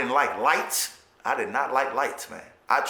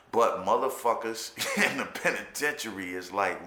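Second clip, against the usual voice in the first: ordinary talk, nothing marked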